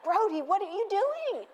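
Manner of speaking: reliving the scare of a naked surprise; startled yell. Retold or imitated. Mom shrieking at her son in the bathroom. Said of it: "And I scream".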